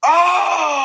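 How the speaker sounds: loud, high cry of pain; pained shout. Retold or imitated. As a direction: yell as the jaw snaps. Jaw snaps during ninth round.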